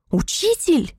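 high, disbelieving "Учитель?"; incredulous. Acted. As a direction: repeat "Учитель?" high with a falling end. Demon repeats in disbelief.

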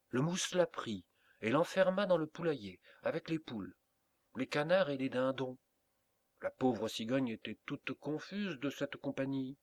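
plain reading voice

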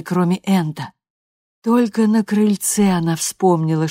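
plain reading voice

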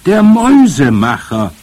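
loud, high, triumphant announcement. A narrator's reading. Grandmother reveals the answer to the boy, triumphant. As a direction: proclaim it like the winning answer. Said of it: "rief meine Großmutter triumphierend".

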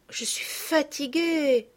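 slow, high, drawn-out complaint; tired child. A narrator's reading. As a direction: whine that you are tired. (fillette fatiguée qui se plaint)

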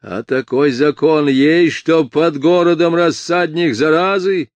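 loud indignant roar with lifted pitch; barked. A narrator's reading. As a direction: bark it out as an irate official. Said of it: "рявкнул бургомистр".

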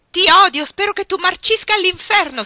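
very loud, high scream of hatred; tearful shriek. Acted. Iggy to Ruth shaking her, tearful furious shriek. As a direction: scream it through tears of rage. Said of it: "strillò Iggy con il viso rigato di lacrime".

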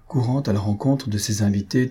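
usual speaking voice

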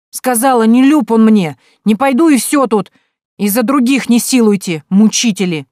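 high, loud stubborn outburst; defiant shout. Acted. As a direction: stamp your foot and yell. Daughter shouts refusal at mother. (упрямый крик)

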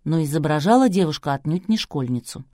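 usual speaking voice

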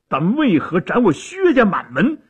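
loud, high, aggrieved demand; acted outcry at an injustice; indignant protest. A narrator's reading. Nephew protests his family's execution.

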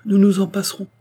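high, loud reassurance; girlish cry, pitched well up; flustered exclamation. A narrator's reading. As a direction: insist brightly that it doesn't matter. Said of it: "s'écria-t-elle".

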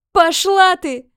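furious shout through tears; angry crying. Acted. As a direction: scream it through sobbing rage. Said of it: "прокричала сквозь слёзы".

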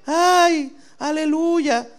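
high, falsetto mock-pious wail; mock tearful aleluya. Pastor mocks a fake crier in church, with a high falsetto wail. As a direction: ham up a fake weepy churchgoer. Mocking crying churchgoer's praise.

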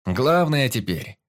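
high, projected caution to the crew; captain's address. A narrator's reading. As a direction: address the whole gathering earnestly. Captain's speech continues.

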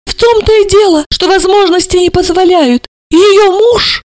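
high, loud outrage; choked with indignation. A narrator's reading. Mother railing about her son-in-law, outraged. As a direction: splutter indignantly at high pitch. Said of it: "задохнулась от возмущения".

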